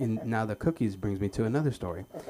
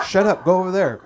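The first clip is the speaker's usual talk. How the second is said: mom's sharp, loud scold, much higher; mock angry mom; 9 semitones higher, 12 dB louder than usual. Mom scolding her son at the mall, loud and high. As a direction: snap at the kid like an irritated mother.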